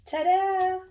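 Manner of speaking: high, loud, sung-out ta-da; playful reveal. Real, not acted. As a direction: present it with a high flourish. Reveals the seasoning.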